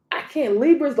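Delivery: ordinary talk, nothing marked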